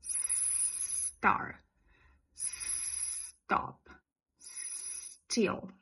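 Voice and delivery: "star, stop, still" whispered on pure blown air, no vowel before the S; just blow the air for "star", "stop", "still". Shown as a demonstration. Teacher blowing the S for students to copy. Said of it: "a só soprar pra falar essa palavra".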